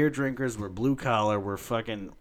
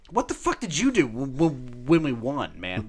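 retorting angrily; 4 semitones higher, 4 dB louder than usual. Retold or imitated. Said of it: "And I was like".

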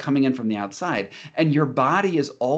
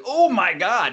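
mock alarmed exclamation; 8 semitones higher, 4 dB louder, slower than usual. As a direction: pitch jumps higher on a startled oh my God. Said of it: "and it's like".

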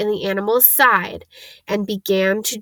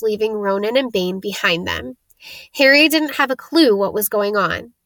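plain reading voice

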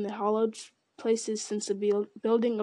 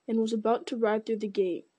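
plain reading voice

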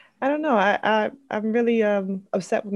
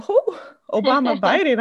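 surprised question; 7 semitones higher, 7 dB louder than usual. Retold or imitated. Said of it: "I said".

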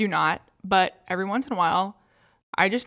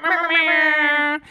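slow meowing; 6 semitones higher, 3 dB louder, slower than usual. Retold or imitated. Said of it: "air horn app where it's like".

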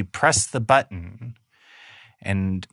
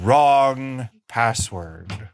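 slow door voice; much slower than usual. Acted. Door rejects password.

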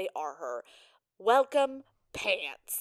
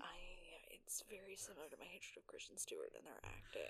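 whisper; 17 dB quieter than usual. Real, not acted. Confessing a hated actor.

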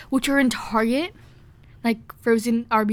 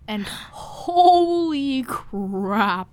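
awed rave; much slower than usual. Real, not acted. Raving about sauce.

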